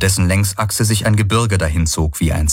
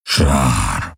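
much lower, drawn-out creature growl; 9 semitones lower than usual. A narrator's reading. Hocks growls on seeing dots in the sky, low growl. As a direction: growl it deep like an alien beast. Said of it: "brummte Hocks".

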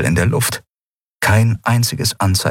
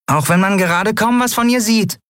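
higher, small creature voice; doubtful little remark; lightly voiced uneasy sidekick; Nelen, wary; 14 semitones higher than usual. A narrator's reading.

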